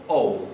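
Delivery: "O" gliding into a long final U; diphthong O. Shown as a demonstration. Teacher demonstrating the accented Icelandic O as a diphthong. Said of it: "es ya literalmente un diptongo".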